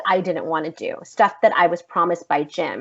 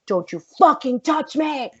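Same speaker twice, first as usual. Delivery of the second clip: high, fierce retold warning; angry warning; 7 semitones higher than usual. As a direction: warn him off sharply, acting it out. Acted reaction to unwanted touch.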